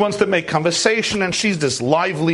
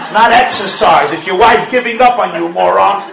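louder, laughing jab at husbands; loud laughing scold; 3 semitones higher, 9 dB louder than usual. Real, not acted. Rabbi scolding clueless husbands, loud and laughing. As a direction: bellow it at the men with a laugh.

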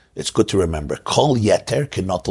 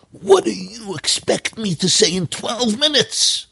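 much higher, slow, disdainful scoffing; scornful professor; 13 semitones higher, slower than usual. Retold or imitated. Vain professor scoffing at the organizer, much higher and scornful. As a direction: sneer it like a vain professor who thinks he's brilliant. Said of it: "in disdain and scorn".

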